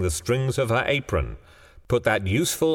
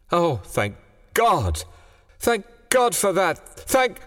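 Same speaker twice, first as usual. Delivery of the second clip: rising, higher relieved thanks; 12 semitones higher, slower than usual. A narrator's reading. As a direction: pour out relief, climbing higher. Mother hears son feels no pain.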